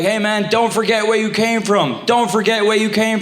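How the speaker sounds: earnest, warning bro voice; a worried friend pleading; concerned buddy voice. Retold or imitated.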